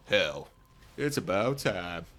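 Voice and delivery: badly faked Southern US drawl; shitty Southern accent. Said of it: "With my shitty Southern accent".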